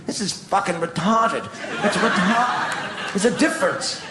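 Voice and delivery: ordinary talk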